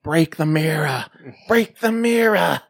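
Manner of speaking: slow, high, drawn-out mock cry; Tangina impression. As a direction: call it out like a hammed-up psychic. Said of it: "she's like".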